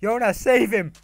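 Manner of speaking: loud, playful alarm; urgent calling out. Pretend. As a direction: shout for your partner to step in. Calls to partner to save someone.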